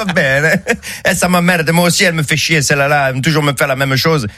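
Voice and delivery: lightly faked Italian moaning; bad Italian accent. Italian builder moans about his lunch in a fake Italian accent. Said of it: "je fais très mal les accents".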